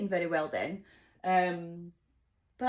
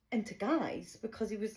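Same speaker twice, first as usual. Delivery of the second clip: ordinary delivery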